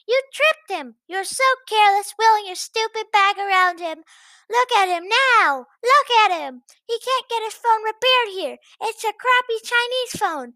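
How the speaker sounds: high, loud furious scolding woman; shrieking chaperone. Acted. Chaperone yelling at the narrator about the bag, furious. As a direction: berate her at full volume.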